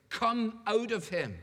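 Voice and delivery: stern. A narrator's reading. Jesus orders the demon out of the man, stern and slow. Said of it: "said Jesus sternly".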